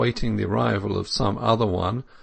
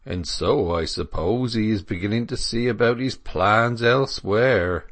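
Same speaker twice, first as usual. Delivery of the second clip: phlegmatic dairyman. Acted. Dairyman supposing Clare will leave, phlegmatic country voice. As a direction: muse bluntly as an unconcerned country dairyman. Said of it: "with a phlegm which unconsciously was brutal".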